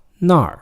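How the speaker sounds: 那儿 high and falling sharply; fourth tone. Shown as a demonstration. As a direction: drop 那儿 firmly from a high start. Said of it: "fourth tone".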